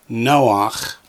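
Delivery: "Noach" as NO-ach, ending on a throaty ch; ah before the guttural in "Noach"; model for echoing. Shown as a demonstration. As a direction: model Noach clearly for repetition. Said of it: "Echo me, please".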